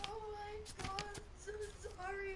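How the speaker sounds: high-pitched mock wailing apology; retold tearful apology. Retelling a drunk friend's apology, mock tearful. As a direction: imitate a distraught friend apologising, voice way up.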